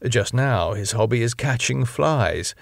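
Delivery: ordinary delivery, nothing marked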